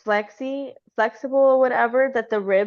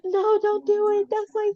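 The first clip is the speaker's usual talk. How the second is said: very high, drawn-out wail; hammed-up high-pitched pleading; mock desperate plea; 13 semitones higher than usual. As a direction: imitate a mother begging in anguish. Voicing mother begging over son's ashes.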